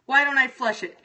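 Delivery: ordinary delivery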